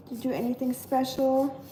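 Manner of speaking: ordinary talk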